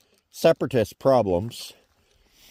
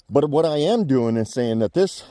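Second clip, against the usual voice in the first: ordinary talk, nothing marked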